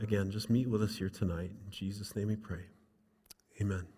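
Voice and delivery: a pastor's gentle prayer fading to amen. Real, not acted. Leads closing prayer.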